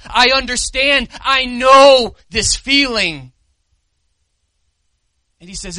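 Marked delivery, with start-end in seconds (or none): 0.0-3.3 loud, slow, impassioned reassurance; fervent comforting cry; Jesus comforting. Acted. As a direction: embrace them with the words. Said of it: "He embraces you and says".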